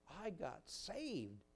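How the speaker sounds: put-on soft, very slow child's voice; little child. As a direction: answer like a shy little kid, drawn out. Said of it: "little boy or girl".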